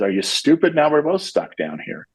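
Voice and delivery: mock exasperation. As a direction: play the stuck man, baffled his friend jumped in. Trapped man scolds jumping friend.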